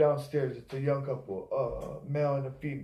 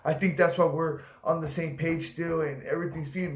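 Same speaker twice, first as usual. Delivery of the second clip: normal talking voice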